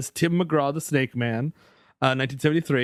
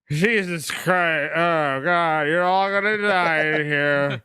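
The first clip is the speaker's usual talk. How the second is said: put-on slow, slurred drunk groan; slower than usual. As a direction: drag the words out like a hopeless drunk. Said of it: "he must be so drunk".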